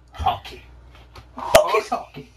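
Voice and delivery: usual speaking voice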